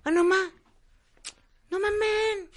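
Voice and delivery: very high mock-distressed whine; hammed-up high-pitched indignation; imagined outraged tycoon. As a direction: complain in disbelief over the raided fridge. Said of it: "va a decir así de".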